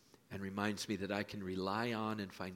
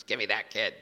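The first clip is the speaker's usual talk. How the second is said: grabby church lady; 4 dB louder than usual. Retold or imitated. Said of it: "the ladies fighting over the baby".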